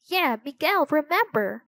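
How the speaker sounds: high, mildly yelled; yelled protest. A narrator's reading. Tall girl objecting to Miguel, yelled. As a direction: chime in with a mild yell. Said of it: "yelled a tall girl".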